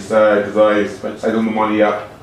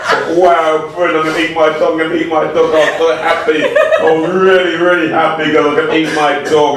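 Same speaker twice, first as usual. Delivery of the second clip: high, giddy and gleeful, with laughs; bubbling over with delight at the thought of the feast; 7 semitones higher than usual. Acted. Comic narrator gloating over his fattening dog, high and giddy.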